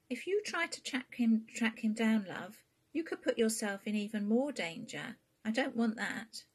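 a fretful mother pleading with her daughter; worried mum. Acted. Queenie warns her daughter.

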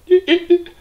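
high squealing monkey laugh; a quick chattering monkey giggle; shrill monkey cackle. Acted. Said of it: "They chattered to the sleeping man".

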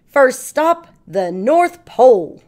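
loud and eager announcement; excited cry. Acted. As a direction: call it out, buzzing to get going. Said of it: "cried Pete".

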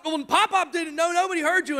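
high-pitched parental scolding; exasperated yelling. Retold or imitated. Father rejects his daughter's excuse, exasperated scolding. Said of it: "I'm sorry for yelling".

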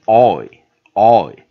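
OY said loud and drawn out; pronunciation demo. Shown as a demonstration. Teacher teaching O plus Y, pronunciation demo. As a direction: stretch OY as one gliding vowel, then repeat it. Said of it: "所以它就念".

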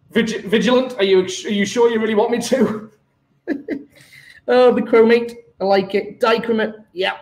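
ordinary talk

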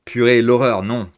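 loud, hammed-up French dismay; mock French exclamation. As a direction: exclaim in French like it's a disaster. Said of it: "Keep the English accent".

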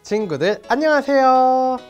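normal talking voice

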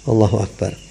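ordinary delivery

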